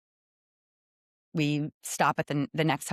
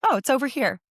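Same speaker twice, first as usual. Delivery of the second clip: put-on higher, louder child's voice, casual; a little girl shrugging off a blunt question; matter-of-fact little girl; 9 semitones higher, 4 dB louder, faster than usual. Girl in wheelchair answers the toddler, higher and casual. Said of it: "she stares right back at her and she goes".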